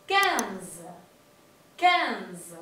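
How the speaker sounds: "quinze" with a nasal E. Shown as a demonstration. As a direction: push the E of "quinze" through the nose. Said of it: "es una E nasal".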